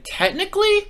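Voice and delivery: high-pitched questioning "Technically?"; retold coy retort. Boss answering an employee who disputes being fired, retold coy retort.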